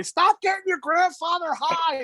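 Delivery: high, slow mock scolding mother; hammed-up scolding mother; mother's voice. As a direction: nag like a put-out mom. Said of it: "my mother used to say".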